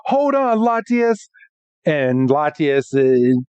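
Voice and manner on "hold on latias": a high-pitched, pleading hold on; urgent plea. Retold or imitated. Ash holding the Pokemon in the water, a high urgent plea. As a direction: urge her to hang on. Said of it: "He's like".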